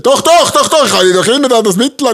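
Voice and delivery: loud high stubborn insisting; insistent old farmer. Retold or imitated. Father insists, voiced loudly. (lautes, stures Beharren des Bauern)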